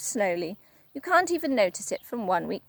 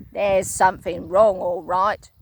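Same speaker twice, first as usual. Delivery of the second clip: lower, louder gruff gloating; Mister Twit, gloating; 4 semitones lower, 6 dB louder than usual. Acted. Husband enjoying his trick, low gloat. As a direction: relish it like a nasty old man. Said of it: "Mister Twit said".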